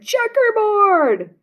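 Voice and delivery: high, stretched classroom call; shouting out. Acted. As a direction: call it out like a teasing kid. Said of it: "Someone else shouted".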